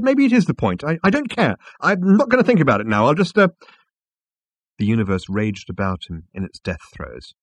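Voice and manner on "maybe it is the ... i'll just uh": high, loud, stumbling protest; agitated, high-pitched dithering; flustered backing down. Acted. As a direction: give in crossly and run out of words. Flustered Arthur backs down.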